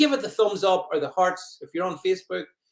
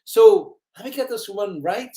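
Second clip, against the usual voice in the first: ordinary delivery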